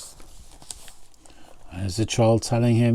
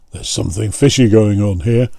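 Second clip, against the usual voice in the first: grumbling; 2 semitones lower than usual. A narrator's reading. A jealous fisherman grumbles, a touch of suspicion. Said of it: "one of them grumbled".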